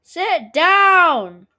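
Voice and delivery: loud high yell. Real, not acted. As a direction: shout the order at him. Yelling at player in game.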